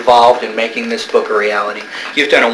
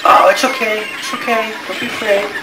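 soothing a pet; 7 semitones higher than usual. Acted. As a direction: coax the little bat gently. Soothing the frightened bat.

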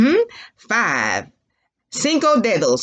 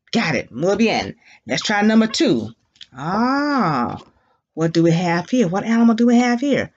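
ordinary talk, nothing marked

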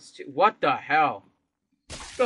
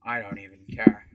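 usual speaking voice

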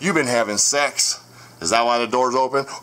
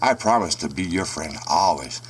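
put-on a lower, hushed creepy-girl line; creepy little girl voice; 8 semitones lower than usual. Retelling the girl at his door, in a hushed creepy voice. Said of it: "and she says".

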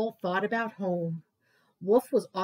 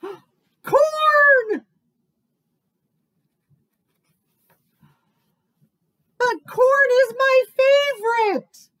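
much higher, loud dismayed cry; 14 semitones higher, 10 dB louder, slower than usual. Acted. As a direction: wail at the loss of corn.

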